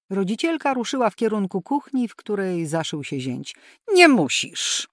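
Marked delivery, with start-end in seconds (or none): 3.9-4.9 abrupt, loud and higher; sharp loud. A narrator's reading. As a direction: snap it out sharply. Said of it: "Gwałtowny i głośny ton córki".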